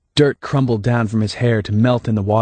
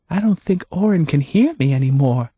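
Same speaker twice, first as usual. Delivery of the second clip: higher, lightly voiced Hannah; Hannah, calm and knowing; 7 semitones higher than usual.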